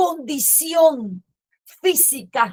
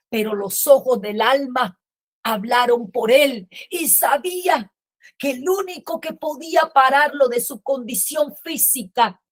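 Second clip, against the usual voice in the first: ordinary talk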